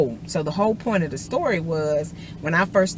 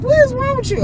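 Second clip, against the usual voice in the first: husband's disbelief; 4 dB louder, faster than usual. Retold or imitated. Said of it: "But he was like".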